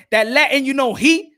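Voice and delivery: ordinary talk, nothing marked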